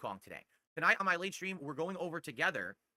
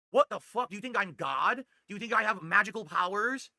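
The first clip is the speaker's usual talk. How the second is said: louder and higher, fed-up disbelief; incredulous outburst; 5 semitones higher, 6 dB louder than usual. Real, not acted. Ranting at detractors online, a loud incredulous outburst. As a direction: shout it at the haters like they're insane.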